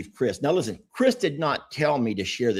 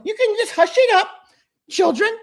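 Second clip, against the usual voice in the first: very high, loud squeaky cartoon-mouse voice; Mickey Mouse voice; 6 dB louder than usual. Host imitates German lady learning English, squeaky Mickey Mouse voice. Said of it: "picture her talking like Mickey Mouse".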